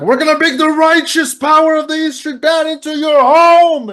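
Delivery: high, loud rock-preacher showman impression. Frontman to halftime viewers at home, mock showman shout. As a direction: build to a roaring, held last word. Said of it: "So it's like".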